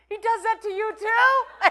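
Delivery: high, slow, laughing disbelief; surprised roommate. Retold or imitated. Jack asking Mick in high amazed disbelief. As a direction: squeak it out, amazed. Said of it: "Jack pipes up and he goes".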